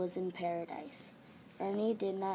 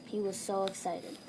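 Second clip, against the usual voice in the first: plain reading voice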